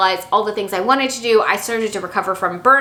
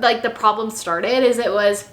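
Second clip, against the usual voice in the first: ordinary delivery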